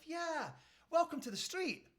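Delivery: high-pitched, chirpy doorstep greeting; imitated cheery neighbour; friendly neighbour. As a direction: introduce yourself in a high, neighbourly voice. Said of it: "says the neighbour".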